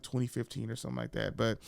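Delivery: ordinary talk, nothing marked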